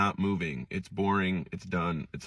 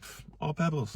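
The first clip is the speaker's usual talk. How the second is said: light brush-off, pitched up; breezy dismissive pff; 6 semitones higher, 7 dB quieter than usual. Pretend. Friend apologizing for the charger, answered with a breezy scoff. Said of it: "You're like".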